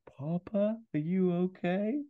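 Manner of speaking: put-on high, slow, questioning child voice; worried small son asking down the phone; little boy. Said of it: "the boy says to his phone".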